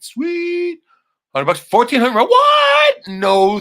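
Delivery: high, loud thrilled squeal; shouting with glee; excited shout. Real, not acted. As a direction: yell it out in disbelief at the deal. Reacting to ammo price.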